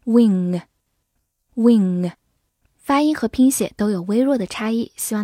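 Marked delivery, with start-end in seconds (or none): 0.0-2.1 "win，win" said as wing, ending in ng; "win，win" with ng in place of final n; back nasal ending. Shown as a demonstration. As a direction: pull the end of "win，win" back to a velar ng. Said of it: "是一个后鼻音".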